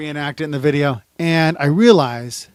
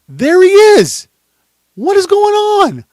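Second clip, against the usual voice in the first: slow, very high, very loud cries; panicked scream; 12 dB louder, slower than usual. Acted. Investigator spotting the gunman rolling up, screaming. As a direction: scream in alarmed disbelief. Acting out shock as gunman reappears.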